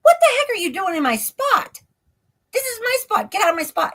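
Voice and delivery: high, indignant protest at an intruder; indignant retold outburst. Retold confrontation, ordering intruder out.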